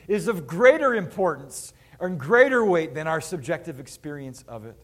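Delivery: loud, driving sermon emphasis; forceful preaching. Acted.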